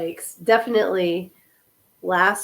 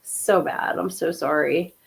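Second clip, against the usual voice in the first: ordinary delivery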